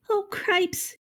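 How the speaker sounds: high-pitched panicky mouse voice; Ferlo's alarmed exclaiming. A narrator's reading. Mouse sees his brother is doomed, alarmed exclaiming. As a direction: exclaim way up high without real volume. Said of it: "shouted Ferlo again".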